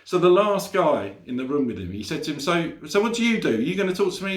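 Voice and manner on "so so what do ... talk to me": higher, eager needling question; eager high chatty voice. Acted. Rob pestering the last guy in the sauna, eager and high. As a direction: push for an answer, all keen.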